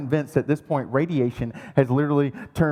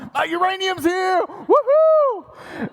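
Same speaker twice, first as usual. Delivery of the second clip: gleeful whoop; much slower than usual. Retold or imitated. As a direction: cheer like a kid on Christmas morning. Thrilled at the uranium box.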